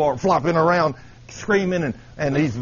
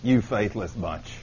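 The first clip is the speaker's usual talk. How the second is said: low, hushed, slow groan of disappointment; disappointed Jesus; 9 semitones lower, 11 dB quieter, much slower than usual. Acted. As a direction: sigh it out wearily, much softer. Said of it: "start talking nice to them, Jesus says".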